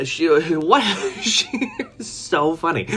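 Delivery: high, breaking up with laughter; real laughter. Real, not acted. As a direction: talk helplessly through the giggles. Cracking up at own story.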